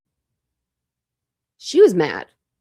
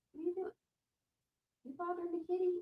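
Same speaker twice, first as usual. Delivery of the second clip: gentle scolding voice for a puppy; pet talk; 10 semitones higher, 16 dB quieter, much faster than usual. Real, not acted. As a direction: coo at the pet, way up high and hushed. Talking to the pet.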